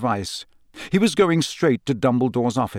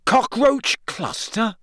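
high, slow, questioning "Cockroach cluster?"; can't believe it worked; amazed; 10 semitones higher, slower than usual. A narrator's reading. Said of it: "he said, amazed".

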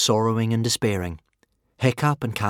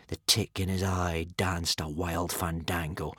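ordinary delivery

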